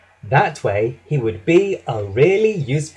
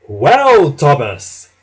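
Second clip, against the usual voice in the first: chuckling; 7 dB louder, much slower than usual. A narrator's reading. Gordon to worn-out Thomas, amused gloating. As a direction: gloat with amusement at a tricked friend. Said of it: "chuckled Gordon".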